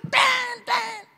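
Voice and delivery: high, drawn-out honk made with the voice; car horn imitation. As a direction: honk twice like an irritated driver. (tiruan klakson mobil, tinggi dan panjang)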